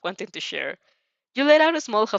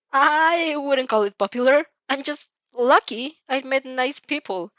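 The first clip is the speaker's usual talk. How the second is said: higher, louder, slow bashful denial; embarrassed modesty; 5 semitones higher, 4 dB louder, slower than usual. A narrator's reading. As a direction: brush off the praise, flustered. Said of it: "Your eyes were round with embarrassment".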